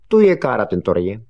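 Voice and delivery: emphatic recited reply; stock answer. Acted. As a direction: fire back the memorised phrase. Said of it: "zase na to Honzík".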